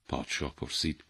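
plain reading voice

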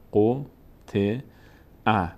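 three separate syllables with pauses between. Shown as a demonstration. Teacher breaks a word down for beginners, syllables apart. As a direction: spell it out one voweled letter at a time. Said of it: "اگه سر هم بخونیم".

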